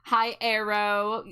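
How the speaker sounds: ordinary talk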